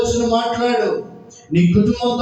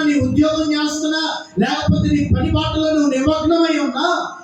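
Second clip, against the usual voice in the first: raised, even sermon cadence, building higher; preaching; 4 semitones higher than usual. Acted. Preacher blessing listeners wherever they work, building preaching cadence. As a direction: roll through the list insistently, pitch climbing.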